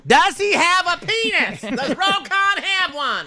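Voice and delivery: high, slow, shrill TV host voice, laughing; mock Nancy Grace. Joking about a lawsuit, shrill slow TV host mockery. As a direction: drag it out high like a scandal host. Mocking a TV host's interview.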